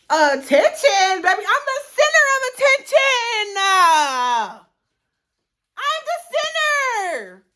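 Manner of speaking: high, loud sing-song mock diva shout; screeching mock center-of-attention voice. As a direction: belt it like a mocking diva. Mocking the girl's attention text.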